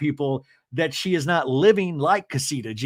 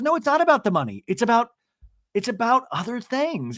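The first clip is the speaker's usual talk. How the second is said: higher, fast insistence; a hammed-up indignant denial; mock Emily protesting; 6 semitones higher than usual. Podcaster imitates Emily denying it's about money. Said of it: "Emily goes".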